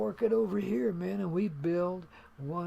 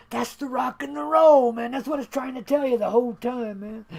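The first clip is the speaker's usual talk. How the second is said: loud, higher on-air announcer hype; radio announcer; 6 semitones higher, 8 dB louder than usual. Acted. Host hyping his radio show to listeners, loud DJ announcer. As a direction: sell the show like a DJ.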